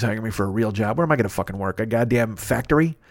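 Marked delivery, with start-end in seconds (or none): none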